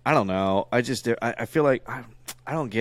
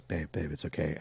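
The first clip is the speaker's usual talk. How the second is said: soothing wife; 5 semitones lower, 10 dB quieter than usual. Retold or imitated. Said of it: "Your wife goes".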